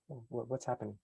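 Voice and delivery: soft, puzzled audience murmur; a hushed confused listener. Retold or imitated. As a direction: wonder aloud quietly. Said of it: "they kind of wonder".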